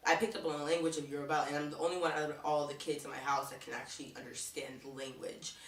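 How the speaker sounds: ordinary delivery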